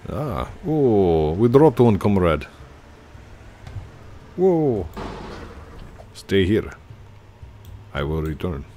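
hammed-up Russian comrade; Russian accent. Gamer plays a Russian to a comrade, Russian accent. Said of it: "it sounds more Russian".

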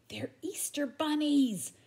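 slow, high excited call; excited shout. Acted. Buster spotting the bunnies, calling out excitedly. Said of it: "shouted Buster".

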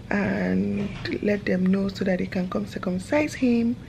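ordinary talk, nothing marked

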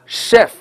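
CHEF with a short open e straight into f; no dark L. Shown as a demonstration. Teacher contrasts chef with shelf for the class, plain short vowel. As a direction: say CHEF clean, no L before the f. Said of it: "前面这个音和后面这个音就能区别开了".

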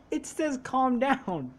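"calm down" drawn out slowly; slow emphatic repeat. Real, not acted. Player repeating the game's message to a friend, slow emphatic repeat. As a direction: say it at half speed, stretching "calm down".

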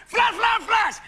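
high, rapid bursts; excited photographer sound effect; mimicked camera flashes. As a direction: fire off the word like a shutter. Imitates camera flashes in plan.

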